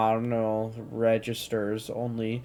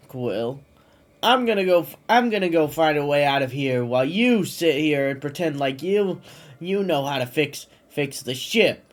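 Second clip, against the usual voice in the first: raised, brash raccoon voice; Rocket voice; 5 semitones higher, 4 dB louder than usual. A narrator's reading. Rocket stomping off from Quill, higher and louder. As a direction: snap it out as the cocky, fed-up Rocket. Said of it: "he heard Rocket say".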